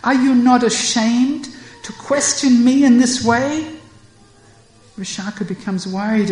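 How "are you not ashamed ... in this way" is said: higher, louder reproach; indignant rebuke. Acted. Radha rebukes Vishaka for questioning her, indignant. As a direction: scold the prying friend.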